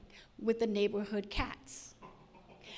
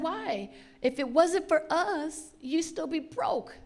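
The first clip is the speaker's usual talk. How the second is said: mock kid voice; 6 semitones higher, slower than usual. Kids voiced demanding money.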